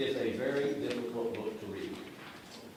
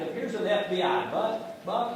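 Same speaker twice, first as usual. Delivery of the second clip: usual speaking voice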